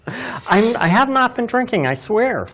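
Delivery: high, excited protest easing down; a bit wired on too much coffee; caffeine-wired. Real, not acted. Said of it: "I am a little wired".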